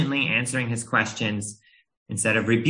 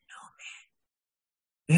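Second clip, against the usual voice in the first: imitating a timid child's whisper; tiny whispered attempt; very quiet voice; 7 semitones higher, 4 dB quieter than usual. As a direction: whisper it like a shy little girl. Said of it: "she would get a very, very quiet voice".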